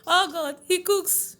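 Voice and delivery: high-pitched delighted outburst; playful exclamation. Real, not acted. Wife teasing a friend about her husband who cooks, playful exclamation. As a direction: exclaim it high, amid laughter.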